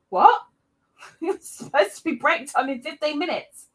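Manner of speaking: high-pitched, incredulous reaction; playfully acted confusion with a laugh; mock bafflement. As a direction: jump up in pitch as if completely thrown. Acts baffled reaction in whisper game.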